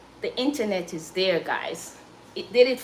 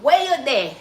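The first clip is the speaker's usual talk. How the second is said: Jamaican Patois phrase; 3 semitones higher, 4 dB louder than usual. Shown as a demonstration. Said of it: "we say".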